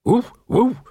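high spoken dog bark; talking dog. Acted. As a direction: say the woofs as words, pitched high. Talking dog says its barks.

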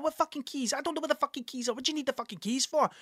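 high, fast, fed-up outburst; exasperated yelling. Retold or imitated. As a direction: snap back in high frustration. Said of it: "I'm like".

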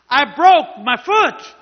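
slow, high, very loud retort; angry yell. Retold or imitated. Fed-up man answering the healer's repeated question, angry yell. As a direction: yell it back word by word, exasperated. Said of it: "He and I were, now were having a yelling match".

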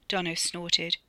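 plain reading voice

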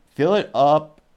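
emphatic reread. Retold or imitated. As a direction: hit "Fill it up" hard, like a punchline.

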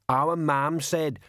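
slow, dogged character voice; plodding big simpleton; deep-voiced thug. A narrator's reading. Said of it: "said a deep voice".

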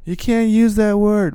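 high, offended protest; high indignant voice. Retold or imitated. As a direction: squeak out the objection. Acted back-and-forth about the word.

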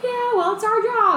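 high-pitched mock commentator voice.

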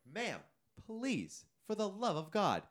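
high, drawn-out plea; acted-out weary begging; exasperated pleading. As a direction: beg an unreasonable stranger to cooperate. Crew member pleading with yelling woman.

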